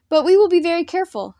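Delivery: high, loud eager exclamation; exclaiming. A narrator's reading. Boy promising the old man he'll be careful, high excited exclaim. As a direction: blurt it out in a rush of excitement. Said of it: "Hacket exclaimed".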